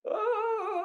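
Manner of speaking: very high, drawn-out "ah"; overwhelmed mock wail. Recalling a house full of Argentines, overwhelmed mock wail. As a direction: let out a high comic wail of overload.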